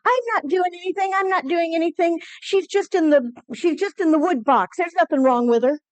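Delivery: high, lightly voiced boy protesting innocence; brother as a boy. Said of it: "I hear my brother saying".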